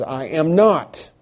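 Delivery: John flatly denying, slow and emphatic. Retold or imitated. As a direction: plant each word firmly. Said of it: "Very emphatic".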